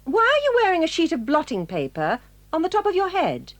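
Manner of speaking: normal talking voice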